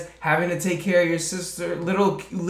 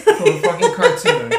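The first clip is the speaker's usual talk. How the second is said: much higher and louder, laughing through it; 6 dB louder than usual. Real, not acted. Cohost repeats her punchline, laughing. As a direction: echo the line laughing in disbelief.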